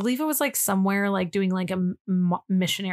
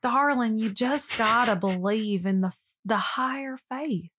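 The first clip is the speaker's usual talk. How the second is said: mock Southern preacher working up the crowd; imitating a creepy Southern minister's drawl; 5 semitones higher than usual. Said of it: "he talks with the Southern drawl when he says".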